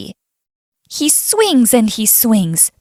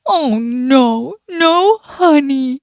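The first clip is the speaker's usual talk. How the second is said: drawn-out cartoon dismay; dismayed bear; 2 semitones higher, slower than usual. A narrator's reading. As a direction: lament it slowly as the storybook bear. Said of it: "he says".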